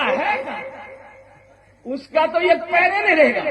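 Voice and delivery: hammed-up angry threat; threatening. Son grabs stick, threatens father.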